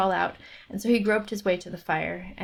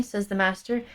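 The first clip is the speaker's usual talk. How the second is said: ordinary delivery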